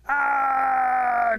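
long, high, loud "aah"; sustained yell of someone plummeting; falling scream. Acted. Turtle falls from the sky, long high scream. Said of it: "now the turtle's falling".

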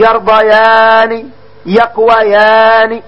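each dual verb يكتبان يضربان stretched slowly, ending ان drawn out; slow model reading. Shown as a demonstration. Teacher drilling dual verbs, each stretched slowly. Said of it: "فهمنا؟".

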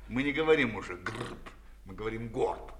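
ordinary delivery, nothing marked

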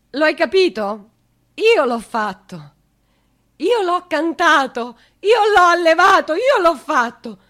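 loud, slow, proud insistence; fierce slow claim. Acted. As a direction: hammer each word home as his mother. Mother claims her son. (rivendicazione lenta e forte, da madre)

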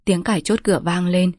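plain reading voice

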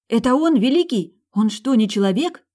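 loud, high-pitched cry of disbelief; startled yell. Acted. Heroine sees huge bird rushing at them, startled yell. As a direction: yell it in shock, voice shooting up. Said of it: "завопила я".

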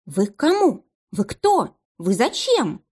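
flustered, meek questions; timid babbling. A narrator's reading. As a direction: babble at the intruders. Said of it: "лепетала Людочка". (испуганный лепет)

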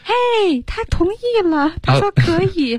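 very high-pitched hollering, no real volume; mock shout across. Acting Rachel announcing he agreed, high mock shout. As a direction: holler the news over in a put-on high voice. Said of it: "对着那边大喊一声说".

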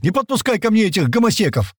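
high-pitched frantic yell; angry outburst. Acted. Паштет yells to keep others off, exploding. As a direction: yell in outraged panic. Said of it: "взорвался Паштет".

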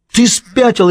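high-pitched, incredulous bark; barked outburst. A narrator's reading. Prisoner reacts to the guard's order, barked outburst. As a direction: snap it out high and agitated. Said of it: "рявкнул Пэл".

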